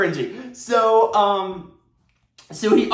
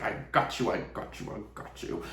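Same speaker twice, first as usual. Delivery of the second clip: hushed, softer and softer, savoring a trap; gloating whisper; 6 semitones lower, 11 dB quieter, faster than usual. Retold or imitated. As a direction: whisper it like you've caught them. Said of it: "I was like".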